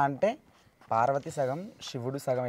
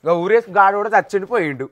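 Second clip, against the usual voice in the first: high, very loud dare; heated, raised-pitch shouting; loud challenge; 8 semitones higher, 12 dB louder than usual. Real, not acted. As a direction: call the bluff at full volume. Daring companion to show the ghost.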